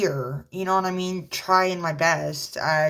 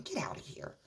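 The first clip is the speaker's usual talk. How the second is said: scornful whisper; 3 semitones higher, 16 dB quieter than usual. Real, not acted. Dismissing the ex.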